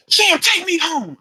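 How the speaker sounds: high, drawn-out mock wail; imitated whiny demand. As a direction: imitate her demanding a ride in a slow, high whine. Drunk woman demanding ride, retold.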